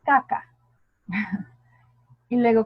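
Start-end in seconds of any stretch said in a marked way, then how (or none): 0.0-0.4 «Caca» dicha más alta y fuerte; ejemplo de palabra. Shown as a demonstration. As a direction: suelta «Caca» como ejemplo gracioso. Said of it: "¿qué podemos poner?". («Caca» con las dos K bien marcadas)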